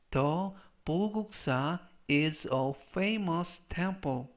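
slow, separated phrases for learners; slow model reading. Shown as a demonstration. Teacher models the temple sentence for learners, slow model reading. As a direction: read it slowly as a language model sentence.

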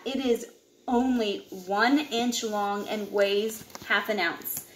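ordinary delivery, nothing marked

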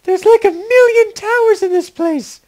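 high-pitched woman's voice; high female voice. Acted. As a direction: play her high and light. Elena voiced.

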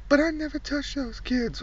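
high, soft breathy protest; whisper-high denial. Acted.

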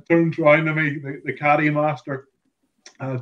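ordinary talk, nothing marked

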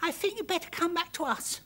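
put-on high, out of breath little girl; panting girl. Girl calling her bird back, panting. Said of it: "panted Arabelle".

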